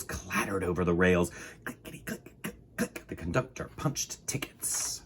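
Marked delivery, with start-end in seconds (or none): 1.6-5.0 soft whispered clicking; whispered clicks. A narrator's reading. Ticket punch sounds.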